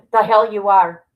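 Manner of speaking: retort. Retold or imitated. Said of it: "I go".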